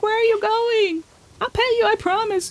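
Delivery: high, loud call after the cat; high frantic plea; frantic pleading. Real, not acted.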